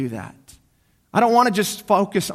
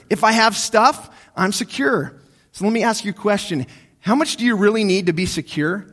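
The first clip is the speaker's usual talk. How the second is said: ordinary talk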